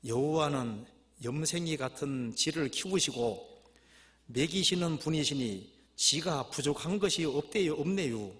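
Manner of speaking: slow, stretched Chungcheong reading; Chungcheong dialect. Acted. Preacher reading the Chungcheong version of Psalm 23, Chungcheong dialect. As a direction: drag the phrase endings in Chungcheong dialect. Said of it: "충청도 버전".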